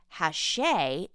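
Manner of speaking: Hachette said "ha-SHAY", stress on the end; French pronunciation. Shown as a demonstration. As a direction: say Hachette with sh and silent t. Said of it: "that is pronounced Hachette".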